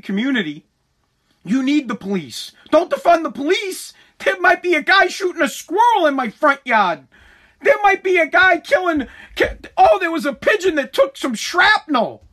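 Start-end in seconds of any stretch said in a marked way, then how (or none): 2.7-12.2 high, hammed-up alarm; mock panic. Gated resident begging for police over a squirrel, mock panic. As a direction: fret over trivia as a grave emergency.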